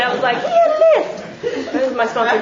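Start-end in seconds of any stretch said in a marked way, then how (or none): 0.4-1.2 mock complaint squeaked way up high; high squeaky mock whine. She mocks her own grumbling about grandfather's list, squeaky high.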